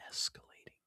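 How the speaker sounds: unvoiced whisper to himself; very soft whispered echo; whispered aside. Real, not acted. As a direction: whisper it under your breath. Repeats a word under his breath.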